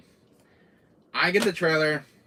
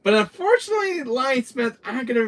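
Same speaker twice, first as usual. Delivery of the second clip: loud outburst; 13 semitones higher, 10 dB louder than usual. Real, not acted. Sudden loud dramatic outburst.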